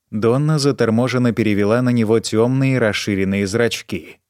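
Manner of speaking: ordinary delivery, nothing marked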